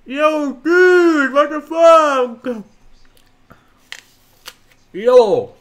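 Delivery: high, loud, startled yell; shocked shout. Real, not acted. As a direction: shriek it out in shock. Startled by stranger on video chat.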